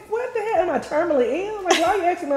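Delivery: high-pitched incredulous protest; retold indignant questioning, voice jumping up; exasperated disbelief. Bride fed up with being asked if she's okay, exasperated.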